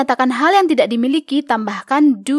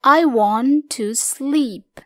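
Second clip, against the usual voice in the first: slow model reading; much slower than usual. Shown as a demonstration. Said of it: "Kamu bisa gunakan kalimat-kalimat berikut".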